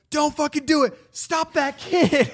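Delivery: high, urgent yell; hammed-up high-pitched command; mock cop shouting. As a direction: imitate cops yelling a warning. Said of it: "they're like".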